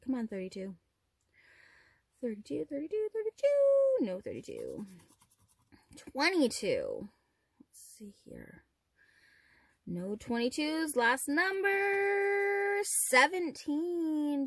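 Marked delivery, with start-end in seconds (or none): 2.2-4.1 yelling at a horse race. Real, not acted. Urging the number like a race. 7.6-8.6 whispering. Real, not acted. Muttering while scanning. 11.0-14.5 slow dramatic announcing. Real, not acted.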